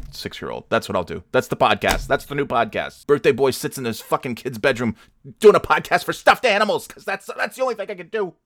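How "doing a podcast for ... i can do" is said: very high-pitched angry yell; exasperated outburst. Real, not acted. Podcaster reduced to podcasting for stuffed animals, exasperated. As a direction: burst out in bitter self-mockery. Self-mocking rant climax.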